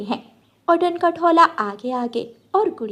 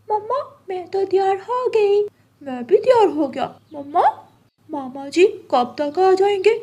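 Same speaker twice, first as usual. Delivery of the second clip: put-on higher eager kid voices; eager children; 8 semitones higher than usual. Children asking mama when uncle comes, higher eager. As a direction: chirp like kids waiting for uncle.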